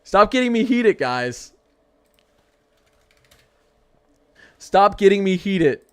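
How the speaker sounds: loud whiny stop-it to the chat; heated. Real, not acted. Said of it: "I gotta stop ranting".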